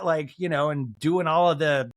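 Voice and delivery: ordinary talk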